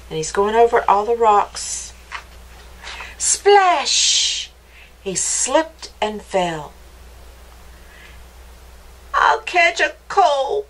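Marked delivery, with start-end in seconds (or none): 3.2-4.5 high, drawn-out splash; splash sound effect. A narrator's reading. Narrator voices mouse falling in the stream, high dramatic splash. 9.1-10.7 high, slow mouse whine; complaining mouse. A narrator's reading. Wet mouse fallen in the stream, slow high complaint. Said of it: "complained Mouse".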